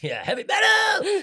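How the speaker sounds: high, loud heavy metal yell; rock shout. Pretend. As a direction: shout it like a rocker. Joking shout about heavy metal.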